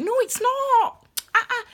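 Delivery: high, drawn-out firm refusal; emphatic contradiction. Acted. Reader rejecting the all-right excuse, emphatically contradicting. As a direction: shoot the excuse down, pitched right up. Rebuts the settling-for-less voice.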